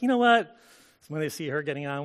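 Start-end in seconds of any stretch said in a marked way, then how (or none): 0.0-0.5 gossiping lady voice. Retold or imitated. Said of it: "the shade's like".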